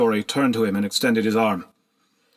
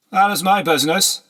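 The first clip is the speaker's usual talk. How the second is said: higher, louder, dismissive; haughty lord; 9 semitones higher, 4 dB louder than usual. A narrator's reading. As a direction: put her in her place. Horseman rebukes slave.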